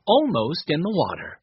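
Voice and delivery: plain reading voice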